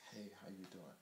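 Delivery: hushed whisper, almost no voice. Retold or imitated. Man sneaking over to greet a woman, whispered.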